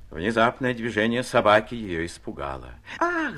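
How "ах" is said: очень высокое испуганное «Ах!»; приживалки разом вскрикивают от испуга; испуганный вскрик. A narrator's reading. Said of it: "закричали разом все приживалки". (высокий испуганный вскрик)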